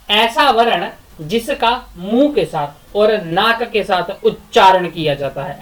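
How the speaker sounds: ordinary delivery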